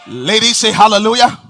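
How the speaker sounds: rally the crowd; preacher's call-out. Acted. Calls out to congregation.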